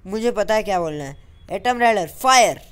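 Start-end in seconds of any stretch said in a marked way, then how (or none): 1.5-2.6 shouted command. Acted.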